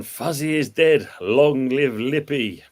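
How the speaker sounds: slow, high herald-style announcement; mock royal proclamation. Reading comment in proclamation voice.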